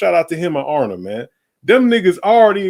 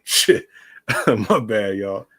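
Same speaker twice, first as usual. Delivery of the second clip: laughing through the apology; 5 semitones lower, 3 dB quieter, slower than usual. Real, not acted. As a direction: shrug it off with a laugh. Laughs at own rambling.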